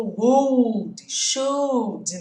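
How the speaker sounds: "would" and "should" as "wud" and "shud", no L; L silent in "would" and "should". Shown as a demonstration. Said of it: "o L é mudo".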